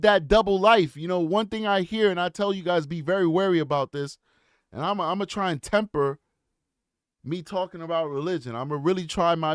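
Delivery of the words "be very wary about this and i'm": ordinary talk, nothing marked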